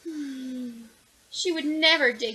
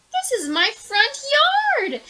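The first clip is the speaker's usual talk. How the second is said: high, loud, slow indignant shout; angry worm shouting at the girl; 10 dB louder than usual. Acted. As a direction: shout it, much higher and slower. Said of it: "shouted the worm".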